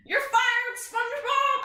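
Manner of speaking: very high cartoon yell; hammed-up firing cry; Mr. Krabs. Boss fires fleeing employee, high cartoon yell. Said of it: "he runs out the front door".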